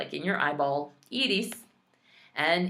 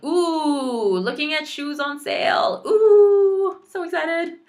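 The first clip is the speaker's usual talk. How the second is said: squealing ooh, much higher and louder; excited ooh; 11 semitones higher, 7 dB louder, slower than usual. Pretend. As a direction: gush over shoes on sale. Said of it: "ooh example acted".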